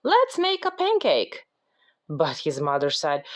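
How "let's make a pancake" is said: high storybook call; mild shout in a high voice. A narrator's reading. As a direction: pitch it up as Jack calling out. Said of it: "shouted Jack".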